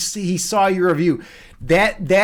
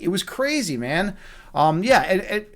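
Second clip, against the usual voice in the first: normal talking voice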